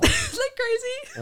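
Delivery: high, slow, through laughter; laughing question. Real, not acted. Amused by her own story, asking laughingly.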